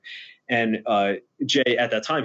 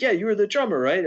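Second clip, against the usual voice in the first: excited higher Jay; 10 semitones higher than usual. Retold or imitated. Jay recalling the drummer.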